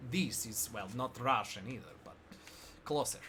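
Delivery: low, soft off-target Russian accent; not quite Russian accent. Shown as a demonstration. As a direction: admit it's closer, still in accent. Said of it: "that was not Russian".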